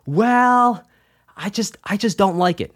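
high, hesitant and embarrassed; sheepish younger self. Retold or imitated. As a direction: say it shyly, unsure of yourself.

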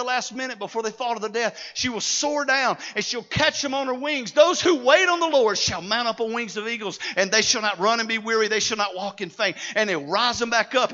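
loud, soaring scripture declaration; full-force preaching. Real, not acted. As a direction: proclaim the verse to the congregation.

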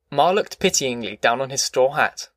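usual speaking voice

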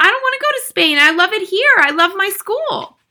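put-on very high, loud child; crying out in protest. A narrator's reading. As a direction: wail a desperate protest. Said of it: "cried Matilda".

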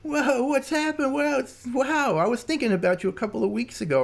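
high, drawn-out excited greeting, imitated; stretched-out, high-voiced amazement at seeing someone; effusive greeting. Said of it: "He goes".

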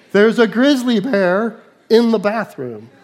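loud, slow alarmed yell; shouting the warning in church. Pretend. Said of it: "and yell".

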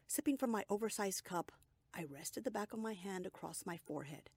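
usual speaking voice

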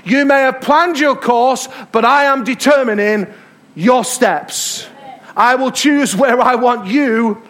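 high, loud, slow proclamation of God's word; full-force preaching. Acted. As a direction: preach it out as God's own voice, slowly.

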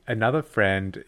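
normal talking voice

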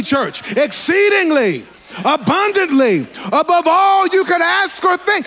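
full-force preaching. Acted. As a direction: preach it with mounting fire to the church. Preacher at full force, church responding.

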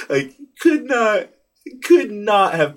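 high, slow words through laughter; laughing while talking. Real, not acted. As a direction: still laughing as you say it. Laughing after son's shout.

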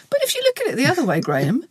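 chirpy know-it-all cheer; annoyingly upbeat. Pretend. Mock cheery advice to Graham.

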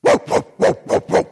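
high, loud, unvoiced yapping woof woof; dog barking. Preacher imitating his dog at the kitchen door, loud high barks. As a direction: bark like an excited little dog. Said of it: "my dog is standing there and says".

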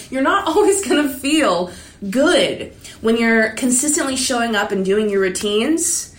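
ordinary talk

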